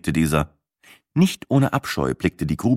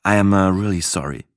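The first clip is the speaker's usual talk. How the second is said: usual speaking voice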